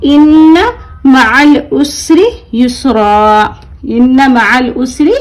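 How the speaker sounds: slow, drawn-out Quran recitation; demonstrated recitation. Shown as a demonstration. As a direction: recite with long held vowels, stopping on an open aa. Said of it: "एक जबर के साथ पढ़ेंगे". (ठहर-ठहर कर तिलावत, लंबी मद के साथ)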